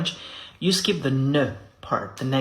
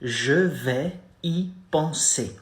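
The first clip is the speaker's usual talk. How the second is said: Je vais y penser drawn out slowly, each syllable separated; slow teaching model; slow demo; slower than usual. Shown as a demonstration. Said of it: "You say".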